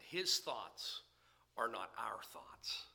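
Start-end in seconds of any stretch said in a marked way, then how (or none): none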